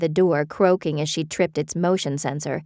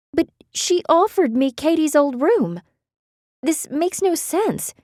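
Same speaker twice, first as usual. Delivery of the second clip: shocked disbelief; 11 semitones higher than usual. A narrator's reading. Beth shocked.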